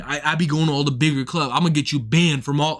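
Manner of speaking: low mock-boastful threat; imitating the headliner. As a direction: mimic his bragging in a deep voice. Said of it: "he's like".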